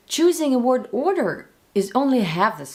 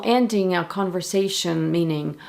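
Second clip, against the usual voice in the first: ordinary delivery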